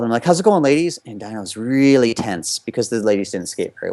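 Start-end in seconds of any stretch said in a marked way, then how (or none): 0.2-1.0 bright, higher breezy hello to the coaches; smiling greeting. Retold or imitated. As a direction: greet them with a forced smile. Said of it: "and I kinda smiled".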